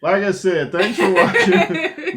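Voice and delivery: high, slow, laughing. Real, not acted. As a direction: giggle through the sign-off. Laughing through outro.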